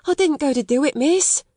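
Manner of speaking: put-on high, pleading servant girl; high, nervous protest; protesting maid. A narrator's reading. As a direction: protest her innocence nervously. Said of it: "protested Becky".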